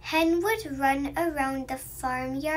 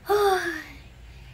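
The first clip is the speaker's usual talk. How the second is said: long drawn-out sleepy yawn; big yawn; 3 semitones higher, 3 dB louder than usual. A narrator's reading. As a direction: yawn like you just woke up. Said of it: "Pig opened his mouth and yawned".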